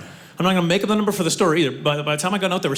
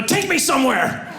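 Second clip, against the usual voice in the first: mock protest; 8 semitones higher, slower than usual. Acted protest over confiscated chapstick.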